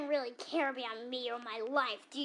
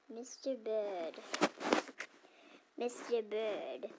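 slow musing; 4 semitones lower, 3 dB quieter, slower than usual. Acted. Reading name slowly.

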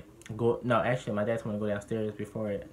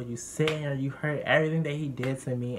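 usual speaking voice

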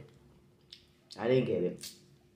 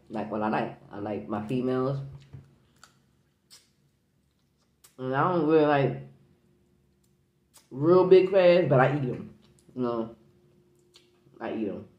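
chewing while talking; eating between words; mouth full of crab; 6 dB louder than usual. Real, not acted. Seen 2: Crab meat in hand and mouth, chewing through the labelled words.

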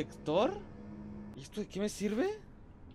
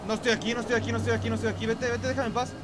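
scared pleading; 6 semitones higher, 4 dB louder, faster than usual. Real, not acted. Hiding, begging monster away.

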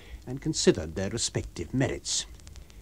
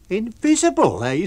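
incredulous; 9 semitones higher, 8 dB louder than usual. Acted. Mocking disbelief at the stranger.

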